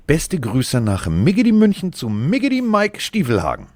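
loud, high-pitched playful greeting; hyped-up podcast greeting; exuberant shout-out. Real, not acted. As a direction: belt out a silly, singsong greeting. Playful shout-out to his co-host.

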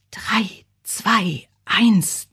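whispered start, building to voice; silent countdown. A narrator's reading. Bazilla waits to pop the paper bag, hushed counting. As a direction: count down secretly, spaced out, whispering at first. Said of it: "zählte sie stumm".

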